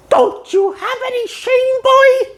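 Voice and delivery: shocked Mr. Krabs. Retold or imitated. Mr. Krabs scolding SpongeBob in high outrage. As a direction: scold him in outraged disbelief.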